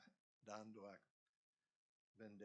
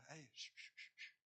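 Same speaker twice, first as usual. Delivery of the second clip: shushing; 2 semitones higher, 6 dB quieter than usual. Retold or imitated. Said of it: "Y él dijo".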